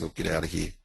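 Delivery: ordinary talk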